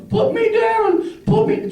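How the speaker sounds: very high, slow falsetto; mock unconscious woman voice. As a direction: squeak it out like a limp woman being carried. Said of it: "one guy's going".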